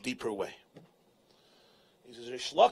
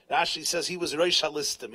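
ordinary delivery

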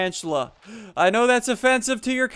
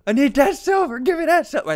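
higher, hammed-up grabby voice; mock greedy voice; 6 semitones higher than usual. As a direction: whine for the silver like a greedy goblin.

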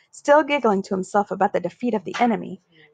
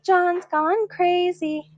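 high, slow, sing-song taunt; mocking yell; 7 semitones higher, much slower than usual. Schoolboy jeering at John, slow sing-song taunt. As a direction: chant it out to jeer. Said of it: "Spider yelled".

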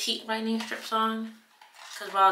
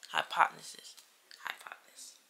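whispering; 2 semitones lower, 9 dB quieter than usual. Shown as a demonstration. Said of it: "repeats title into whisper".

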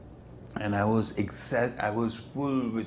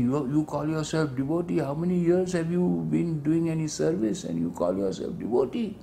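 higher, scornful challenge; imitating a furious rebuke; 6 semitones higher than usual. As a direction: demand it with contempt. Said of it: "So he was furious and he was saying".

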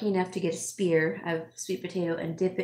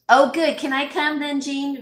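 bright, higher and louder, teasing; playful excitement; 6 semitones higher, 7 dB louder than usual. Real, not acted. Teasingly asking Jean to come for dinner, excited. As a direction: pop up eagerly, inviting yourself over. Teasing invite to a friend's cooking.

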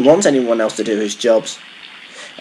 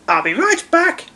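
very high-pitched engine promise; high character voice; puffing little engine. A narrator's reading. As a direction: call it out as a tiny eager engine. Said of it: "puffed Stanley".

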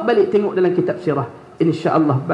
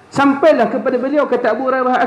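ordinary talk

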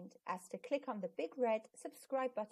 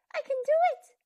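much higher, much louder shout of joy; thrilled child who suddenly gets it; joyful cry; 12 dB louder, faster than usual. Acted. Zack's sudden joy with huge smile.

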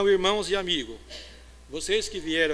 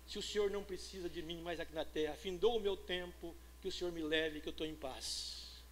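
hushed retold prayer; 13 dB quieter than usual.

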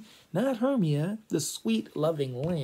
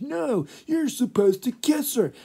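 high, loud bickering actor; mock quarrel, pitched way up; frustrated squabbling; 10 semitones higher, 6 dB louder than usual. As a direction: squabble like exasperated amateur players. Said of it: "they're getting frustrated with each other".

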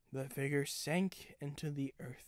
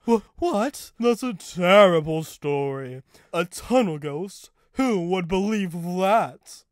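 loud, snobbish disbelief; haughty, loud incredulity; snobbish scoff; 4 semitones higher, 14 dB louder than usual. A narrator's reading. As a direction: scoff loudly, looking down on the story. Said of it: "snobbed Fulcrum".

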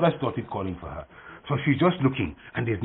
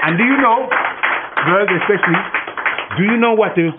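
higher, louder question to the class; calling out; 8 semitones higher, 5 dB louder than usual. Real, not acted. Teacher calling to the children.

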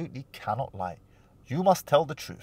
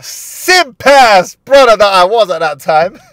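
high and loud, slower, laughing at himself; mock self-roast; 8 semitones higher, 15 dB louder, slower than usual. Real, not acted. Host mocking his sixteen-year-old self, high and loud. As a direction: roast your younger self.

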